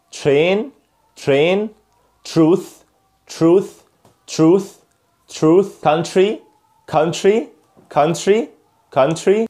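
"tr" said as "chr" in every word, as in "Travel", "Tradition", "Truth"; isolated model words like "Train", with "chr" for "tr"; American TR drill. Shown as a demonstration. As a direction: model "Country" and "trouble" with a sh between t and r. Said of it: "بنزود ال اس اتش ما بين ال T وما بين ال R".

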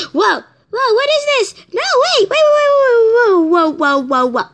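high panicked whoas. Acted. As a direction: yelp in alarm. Reporter suddenly lassoed.

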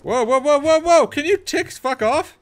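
high, loud panicked whoas at the bugs; panicked yelling. Real, not acted. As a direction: yelp as the ticks swarm you. Ticks attacking him in game.

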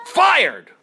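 Fired! blurted high and loud; sudden gleeful yell of Fired!; triumphant shout. Acted. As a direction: bark out Fired! proudly. Boss repeats it triumphantly.

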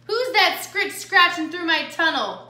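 growling. A narrator's reading. Coyote challenges whoever is in the tunnel, lightly growling. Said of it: "growled a voice".

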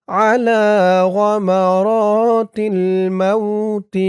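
slow, melodic Arabic chant; Quranic-style recitation. Acted. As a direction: recite the dua like tilawat, unhurried.

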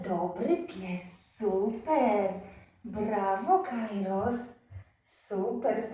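soft, slow praise for a good dog. Real, not acted. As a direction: reward him gently.

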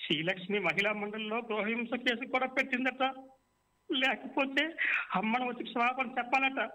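mild tearful wail, pitch climbing high; crying. A narrator's reading. Son tells his father why his life is unbearable, tearful. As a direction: pour out his despair in tears. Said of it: "అంటూ గిరీశం ఏడుస్తాడు".

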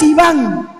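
ordinary talk, nothing marked

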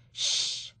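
breathy unvoiced shush. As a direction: hush the noisy crowd. Audience hushing in cinema.

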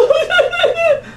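high squealing laughter. Real, not acted. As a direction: shriek with amusement. Laughs at rant.

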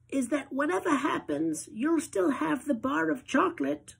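put-on high, quavery-free old-lady voice; Grandma Georgina reassuring Charlie, high old-lady voice; grandmother voice. A narrator's reading. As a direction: pitch it way up like a granny.

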